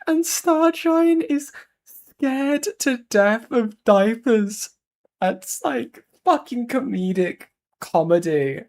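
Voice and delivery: high squeaky voice cracking with laughter; barely getting words out through giggles; laughing hysterically. Real, not acted. Streamer reacting to the diaper fetish reveal, laughing hysterically.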